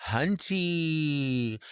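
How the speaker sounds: "hunty" with a crisp t and long ending; drawn-out "hunty"; hunty variant. Shown as a demonstration. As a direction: hit the t in "hunty", stretch the end. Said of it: "they're all so different".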